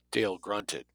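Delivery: ordinary delivery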